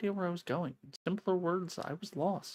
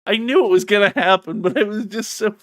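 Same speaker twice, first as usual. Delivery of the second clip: much louder, laughing it off; loud excited reaction; 5 semitones higher, 14 dB louder than usual. Real, not acted. Streamer reacting to the shock, loud and excited.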